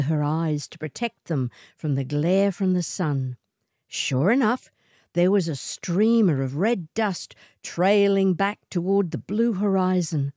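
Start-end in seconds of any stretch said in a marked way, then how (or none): none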